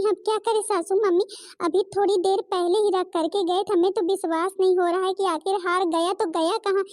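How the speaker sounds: put-on distressed young daughter-in-law; panicked young bride. Bride pleading she searched.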